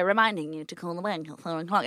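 a mild mock of a sinister assistant; creepy robot voice. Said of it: "in a really creepy voice".

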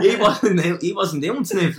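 high, insistent retold protest; mock pleading. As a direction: start loud and high, easing slightly in volume. Mate pleads with security guard.

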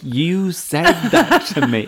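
high, slow, loud protest; insist, half-laughing in disbelief; indignant. Retold or imitated. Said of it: "And I'm like".

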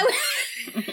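high laugh through the words. Real, not acted. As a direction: break into giggles. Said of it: "laughing at the kid".

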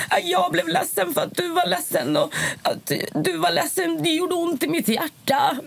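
slow, very high tearful wail, imitated; hammed-up sobbing little one pouring out hurt; Josef breaking down. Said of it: "Så bara bröt han ihop".

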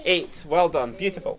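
bright high classroom voice; teacher voice. Acted. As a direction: talk up to young pupils.

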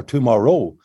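"tomorrow" with the last syllable stressed, to-mor-ROW; misplaced stress. Shown as a demonstration. Songwriter showing what never to sing, end-stressed "tomorrow". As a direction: push the weight onto the final "row" of "tomorrow". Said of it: "the emphasis on a word".

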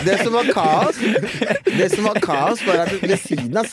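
high, loud telling through real laughter; laughing while telling. Real, not acted. Recounting the chaos at home, laughing. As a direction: tell it while cracking up.

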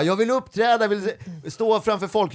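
high, whining eager voice; exaggerated longing to perform; mock eagerness. Mimics others eager to perform.

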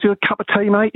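high-pitched mimicked London accent. Young punk offering his guest tea, imitated London accent. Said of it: "he had a really London accent".

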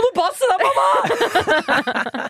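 high-pitched imitation of a concerned child; child warning mum. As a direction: squeak it like a little kid, half laughing. Voices child warning crying mother.

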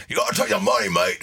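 mimicked English accent, falling at the end. Short rock stars standing there insisting on payment, put-on English accent. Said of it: "he had this English accent".

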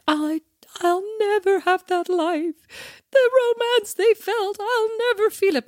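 high, slow, hammed-up lovesick wail; mock a teenage girl's tragic longing; mock melodrama.

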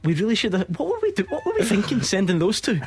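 high-pitched, laughing as he talks; amused, voice lifted high with laughter; laughing disbelief. Real, not acted. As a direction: crack up while asking in disbelief. Host cracking up at colleague's biceps.

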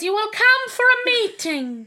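loud, high, imperious royal command; mock-stern monarch, high and drawn out; the Queen. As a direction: order it slowly in a put-on regal voice. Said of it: "I'm really good at doing the Queen".

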